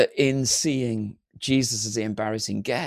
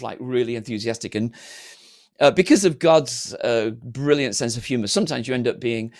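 normal talking voice

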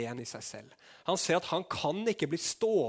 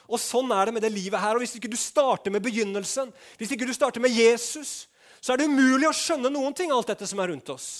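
higher and louder, driving home each line; full-force preaching; 9 semitones higher, 9 dB louder than usual. Acted. Preacher drives the point home to the congregation, loud and high.

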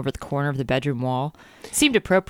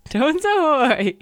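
laughing; 12 semitones higher, much slower than usual. Acted. Laughing repeat, slow.

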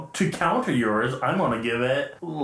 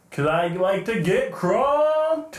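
slow, drawn-out party whoop, pitched up; party boy; 5 semitones higher, much slower than usual. Pretend. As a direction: stretch it into a mock party-boy holler. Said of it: "Party boy likes his blackouts".

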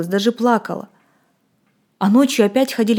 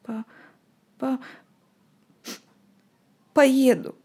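reluctant stammer; 4 semitones higher, 4 dB quieter, slower than usual. Acted. Boy stammering, giving in.

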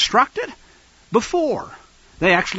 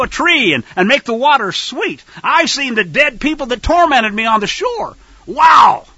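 excited; 6 semitones higher, 4 dB louder than usual. Acted. Imagined Israelite recalling miracles.

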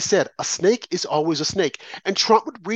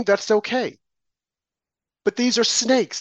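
usual speaking voice

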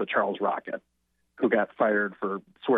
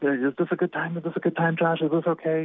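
mildly anxious, polite checking, slightly higher; gently apologetic retelling of a shy caller; 4 semitones higher than usual. Retold or imitated. Shy comedian calling the interviewer, apologetic. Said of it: "very, you know, apologetic".

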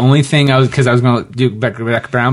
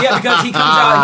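usual speaking voice

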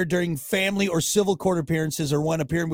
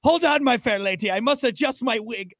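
higher, mannered olde English voice; old-timey gentleman; 5 semitones higher than usual. Pretend. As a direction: play a fussy powdered-wig gent.

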